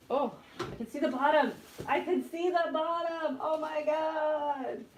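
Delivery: high, slow, delighted exclaim; mock excitement. Real, not acted. To viewers, seeing the fridge bottom, mock-amazed high. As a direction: gush over the empty fridge bottom.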